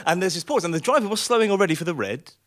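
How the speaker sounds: ordinary talk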